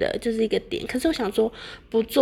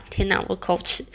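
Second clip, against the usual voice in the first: ordinary talk, nothing marked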